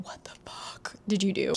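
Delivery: hushed furious whisper. Retold or imitated. Whispered retelling of angry manager.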